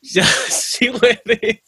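amused, breaking into laughter; laughing. Real, not acted. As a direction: say it through a drawn-out laugh. Laughs while recounting the rant.

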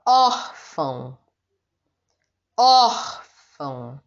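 órfão with a long, strong ór; slow demo of órfão, ór strongest; stress on ÓR. Shown as a demonstration. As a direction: stretch the first syllable of órfão, let fão drop. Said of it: "O or é mais forte".

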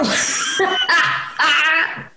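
high, squeaky retelling through laughter; helpless with laughter, words squeezed high; real laughing. Real, not acted. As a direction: gasp out the story while cracking up. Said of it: "it makes me laugh so much".

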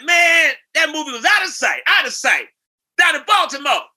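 put-on loud, high, raspy-free hype voice of an old soul star. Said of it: "And he said".

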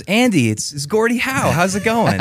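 cheerful celebrity ringing up; mock Gordie Howe. Gordie Howe calling the dad at work, high mock voice.

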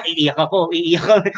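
ordinary talk

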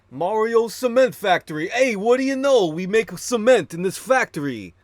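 high, loud goofy announcer impression; toy commercial narrator. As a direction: ham up the cheesy ad narrator's accent. Mimicking the commercial's odd narrator.

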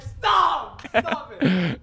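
high, frantic mock shout; panicked shout. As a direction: yell at him to stop coming over. Begging coach not to come.

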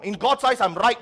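indignant claim. Retold or imitated. Pastor mimicking a self-righteous adult, loud indignant insistence. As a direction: proclaim it, sure you're in the right. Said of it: "We somehow think".

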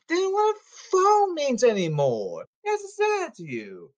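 high mocking squeal; mock them in a high squeak. Mocking social media, high voice.

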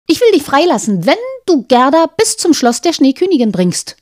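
put-on a brash young robber girl. Robber girl speaks in story.

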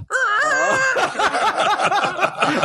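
long, high burst of laughter; high-pitched helpless laugh. Real, not acted. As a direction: crack up at your own story. Laughing at own awkward moment.

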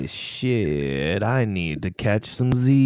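low, slow, lazy drawl; lightly voiced sleepy rapper, slowly. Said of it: "[name] would say".

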